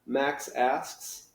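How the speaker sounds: usual speaking voice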